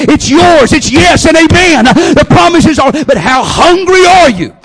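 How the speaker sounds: loud, high, driving preacher cry; preaching at full force. Real, not acted. Preacher crying out to the church, loud and rising. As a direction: build the congregation to a shout.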